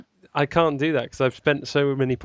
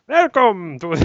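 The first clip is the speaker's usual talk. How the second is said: loud hammy German welcome; mock German shout; 6 semitones higher, 7 dB louder, slower than usual. Host dared to use the accent, loud fake German. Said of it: "do your German accent there".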